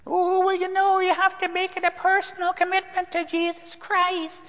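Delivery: put-on harsh Chicago accent. Preacher mimicking friend's mother questioning him in harsh Chicago accent. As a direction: drag out the pious question like a caricatured Chicago mother. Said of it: "a very harsh Chicago accent".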